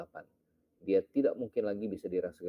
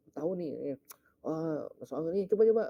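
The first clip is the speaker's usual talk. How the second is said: higher and rising to a loud call of coba; fussy voice building louder; a congregant urging a remedy, excited; fussing churchgoer; 9 semitones higher, 4 dB louder, faster than usual. Retold or imitated. Churchgoer diagnoses a chill.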